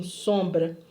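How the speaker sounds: low, meek imitated answer; obey timidly, as you did then; small obedient voice, acted; retold timid reply.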